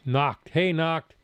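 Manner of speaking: ordinary talk, nothing marked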